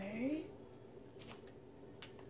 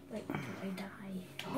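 much softer, lower mutter to himself; muttered aside; 5 semitones lower, 15 dB quieter than usual. Real, not acted. Gamer grumbling to himself mid-fight, soft mutter. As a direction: say it under your breath. Muttered then repeated loudly.

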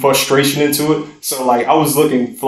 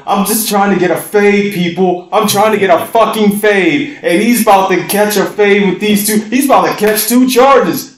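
higher and louder, incredulous; worked-up disbelief; 7 semitones higher, 4 dB louder than usual. Real, not acted. Telling friends about a haircut turned fight, worked up and loud. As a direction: rant it like you still can't believe it.

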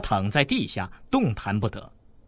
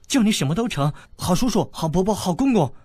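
put-on higher, breathless young girl; eager girlish bargaining; 8 semitones higher, 3 dB louder than usual. Princess eagerly offers the boy any title he wants.

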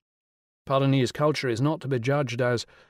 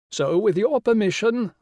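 grand regal lady, pitched high and louder; rich royal voice; 9 semitones higher, 5 dB louder than usual. Acted. The Rani greeting Will on the phone, rich and regal. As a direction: sound like a queen condescending down the phone. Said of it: "the rich royal voice".

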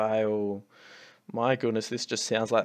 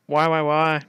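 louder, despairing why why why; exasperated cry; 3 semitones higher, 7 dB louder, slower than usual. Real, not acted. Gamer watching his failed attack, crying out in despair. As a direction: throw the question at the screen in despair.

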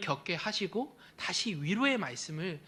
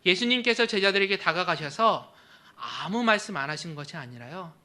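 usual speaking voice

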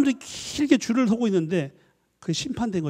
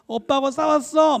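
put-on child's voice; 8 semitones higher, slower than usual. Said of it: "아이가 우-, 웁니다".

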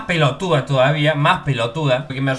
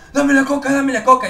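thug demand; 8 semitones higher, 4 dB louder than usual. Retold or imitated. Thugs demanding his soda.

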